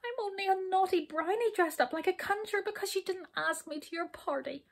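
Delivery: high, whining confession, fading at the end; tearful wail. A narrator's reading. Hop confessing to the king in tears, wailing. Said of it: "big tears beginning to pour down his cheeks".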